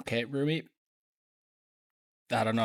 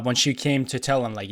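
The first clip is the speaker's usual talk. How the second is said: usual speaking voice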